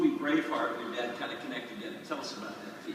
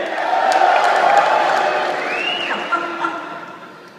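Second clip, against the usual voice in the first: laugh; 15 dB louder than usual. Real, not acted. Host laughing after crowd.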